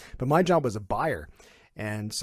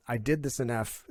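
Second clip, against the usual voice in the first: ordinary delivery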